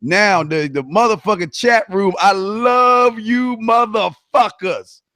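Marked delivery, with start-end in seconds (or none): 2.2-5.0 high, drawn-out declaration of love; exuberant affection. Real, not acted. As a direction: stretch it out for the whole crowd. Heartfelt shout-out to chat room.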